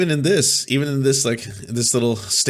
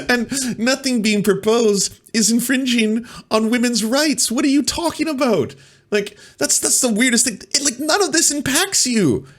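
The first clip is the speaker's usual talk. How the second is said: very high falsetto, baffled and pleading; mock-distraught falsetto with laughs breaking in; exasperated ex-wife voice; 11 semitones higher than usual. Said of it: "I feel like your ex-wife right now".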